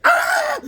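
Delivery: very high, loud yelp of pain; imitating a kid hit by a snowball; pained scream. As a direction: shriek like you just got hit. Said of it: "he's like".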